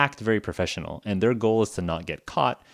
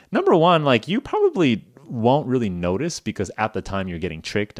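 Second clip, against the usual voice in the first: ordinary delivery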